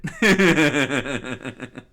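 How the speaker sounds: loud, high burst of laughter; long cackling laugh at own joke; real laughing fit. Real, not acted. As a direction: crack up helplessly.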